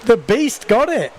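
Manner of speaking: high excited commentary shout. Real, not acted. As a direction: call the win with a burst of excitement. Live call of comeback win.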